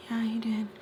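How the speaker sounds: soft, whispery comeback; hushed mock contradiction; imitated quiet insistence. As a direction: insist under your breath, mimicking her. Imitating the girl's sly insistence.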